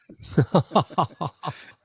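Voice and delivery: real loud laugh; hearty laugh breaking out; burst of laughter. Real, not acted. Punchline lands, both laugh.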